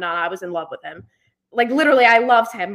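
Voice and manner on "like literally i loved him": emphatic. Real, not acted. Emphatic confession of childhood love.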